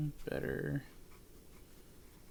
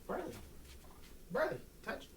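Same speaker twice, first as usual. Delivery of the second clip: high dog cue; 8 semitones higher, 9 dB quieter than usual. Real, not acted. Calling dog to do touch.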